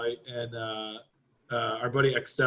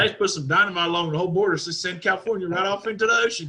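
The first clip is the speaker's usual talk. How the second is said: put-on Texas accent; 4 semitones higher than usual. Texan ranting about California, mock Texas accent. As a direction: rant in a hammed-up Texas twang. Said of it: "Speaking of turning up in Texas".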